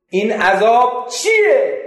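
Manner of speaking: high and loud, slowly dragged; loud anguished question. Retold or imitated. Narrator shouting at the cursed man, anguished question. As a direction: cry the question out.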